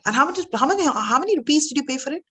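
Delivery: high, quick, nosy questioning; pry about the price with eager curiosity. Acted. Guest eagerly asking the rich man the price.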